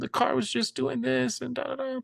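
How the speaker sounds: hammed-up high-pitched begging to the officer; mock begging. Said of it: "she just was like".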